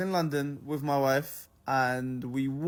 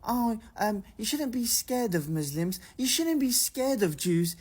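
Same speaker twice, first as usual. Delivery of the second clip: higher, lightly voiced drunk passer-by; mock drunk stranger; 5 semitones higher, slower than usual. Storyteller imitating drunk strangers addressing him and his wife, mock remark. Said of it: "the first thing they say is".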